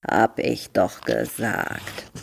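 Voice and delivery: low, soft and slow; smug turtle voice. A narrator's reading. Turtle Hildegard answers Fritz smugly, low and slow. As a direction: say it lazily like a know-it-all tortoise.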